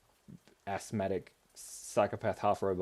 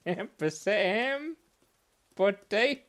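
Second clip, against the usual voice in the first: much higher, drawn-out, silly delivery; exaggerated naming; 10 semitones higher, 7 dB louder, slower than usual. Playing with the drawing's name, exaggerated. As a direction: stretch the syllables out high and loud.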